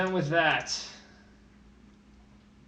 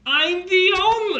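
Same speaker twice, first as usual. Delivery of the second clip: high, loud, drawn-out protest; stretched, high-pitched objection; indignant outburst; 13 semitones higher, 6 dB louder, slower than usual. Pretend. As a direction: cry out in mock outrage. Indignant retort to business partner insult.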